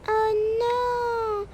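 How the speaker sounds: high, slow mock sob of a little girl; a hammed-up child's cry; little daughter pretend-crying to her mother, high and slow; fake crying child. Child crying over moving.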